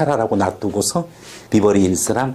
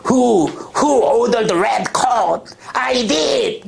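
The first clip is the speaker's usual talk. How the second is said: high, very slow, then a sharp higher I did; courtroom climax; 13 semitones higher, much slower than usual. Acted. Teacher quotes the courtroom climax from the movie, high and dramatic. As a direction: ham up the famous movie showdown. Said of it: "마지막 법정에서".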